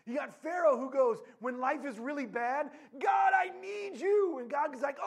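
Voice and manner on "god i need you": loud, high, drawn-out plea; desperate cry. Retold or imitated. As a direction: cry out to God, hammed-up. Pharaoh cries to God in trouble.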